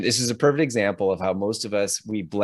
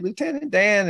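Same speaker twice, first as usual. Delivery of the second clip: Forrest calling out; 12 semitones higher than usual. Retold or imitated. Forrest hails Lieutenant Dan from the water, high calling voice. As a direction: call out like Forrest.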